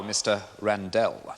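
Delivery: Randell said slowly as ran-DELL; over-articulate Randell, weight on the final DELL; pointed corrected name. Acted. Counsel repeating the witness's corrected name, Randell drawn out pointedly. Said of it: "My name is pronounced Randell".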